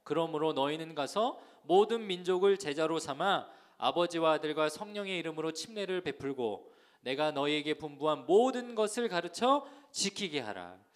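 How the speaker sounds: loud, measured verse reading; firm, projected Bible recitation; scripture read-along. Real, not acted. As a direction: lead the congregation in reading aloud. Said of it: "한번 같이 한번 읽어볼까요?".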